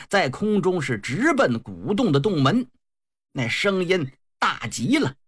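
usual speaking voice

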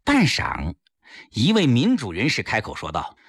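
usual speaking voice

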